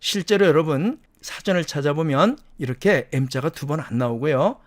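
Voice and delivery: normal talking voice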